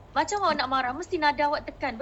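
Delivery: ordinary talk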